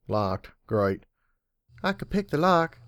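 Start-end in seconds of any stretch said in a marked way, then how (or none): 1.8-2.8 higher light filly voice; young filly voice. A narrator's reading. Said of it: "Serenity said".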